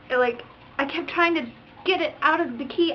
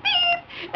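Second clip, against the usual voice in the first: very high, sustained beep; squeaky high "beep"; imitated car horn. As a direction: mimic a little horn honk.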